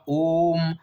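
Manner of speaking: ओम said slowly with the vowel held long. Shown as a demonstration. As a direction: draw out ओम like a chant. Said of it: "ये अक्षर जो है देर तक बोलना पड़ता है".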